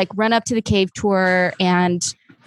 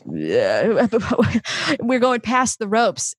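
ordinary talk

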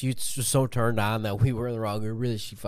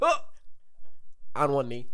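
ordinary talk

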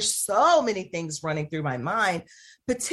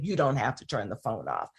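lower, firm and steady; 7 semitones lower, 3 dB quieter than usual. Retold or imitated.

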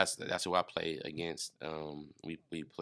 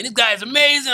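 shouted, much higher and louder gushing; mock praise; 16 dB louder than usual. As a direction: gush it out as a hyped fan. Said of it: "they be like".

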